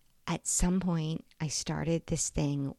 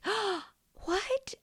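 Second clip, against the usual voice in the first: much higher gasp and shocked what. Retold or imitated. Mother learning she missed her son's hug, a high shocked gasp. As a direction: gasp in dismay.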